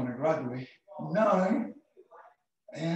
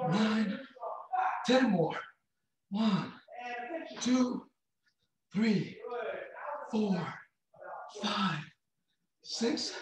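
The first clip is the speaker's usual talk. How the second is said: counting reps; 6 semitones higher, much slower than usual. Acted.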